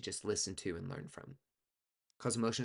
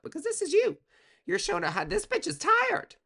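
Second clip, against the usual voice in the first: shrieked way up high and loud; a piercing, exasperated high shriek; mock shriek; 10 dB louder than usual. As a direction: squeal it like the tiredness is unbearable. Tarot reader shrieks at client's exhaustion.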